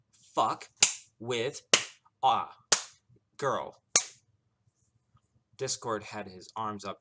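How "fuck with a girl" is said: put-on "Fuck with a girl" punched word by word, loudly; every word emphasized. A narrator's reading. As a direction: separate "Fuck", "with", "a", "girl" like blows. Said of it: "she emphasized every word by whipping".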